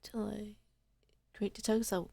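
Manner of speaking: toneless breathy whisper of the line. Shown as a demonstration. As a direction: breathe it like a secret.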